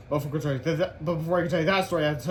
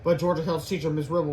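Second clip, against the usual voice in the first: plain reading voice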